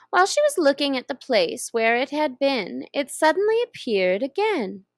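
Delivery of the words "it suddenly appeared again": plain reading voice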